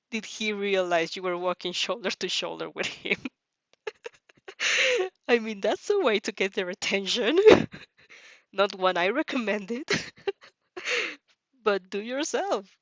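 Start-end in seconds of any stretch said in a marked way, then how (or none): 4.5-5.2 loud high cackle; high burst of laughter. Real, not acted. Reader amused by the vampire's approach, high burst of laughter. 8.5-12.8 laughing aside. Real, not acted. Reader joking to listeners, laughing aside.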